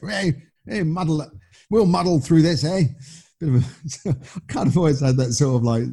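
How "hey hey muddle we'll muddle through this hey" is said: a light mock wartime cheer, pitched higher; hint of a plucky Blitz-era Brit, jolly and brave; lightly hammed-up keep-calm-and-carry-on voice; stiff upper lip wartime spirit. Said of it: "a bit of a wartime spirit, you know, stiff upper lip".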